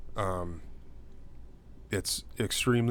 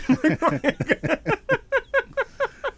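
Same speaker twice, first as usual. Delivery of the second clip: loud high-pitched laughing fit; real laughter at the punchline; 7 dB louder than usual. Real, not acted. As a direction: laugh out hard. Big laugh after joke.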